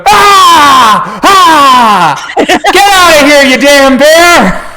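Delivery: high, loud hammed-up battle screams; [name] yelling. Man pretending to drive off a bear, full loud yell. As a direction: scream as if fighting off an animal. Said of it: "you hear [name] going".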